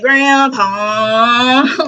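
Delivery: high whiny "Grandpa" in a mocking drawl; mock bratty kid. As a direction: mimic a whining brat. Reader mocks girl's whine.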